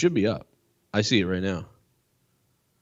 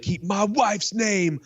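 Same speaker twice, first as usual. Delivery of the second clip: slow, higher menacing [name] warning, spelled out; imitating [name]; 7 semitones higher, slower than usual. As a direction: drag each word out like a threat. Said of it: "[name] was like".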